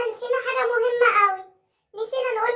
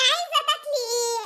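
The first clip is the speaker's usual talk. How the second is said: normal talking voice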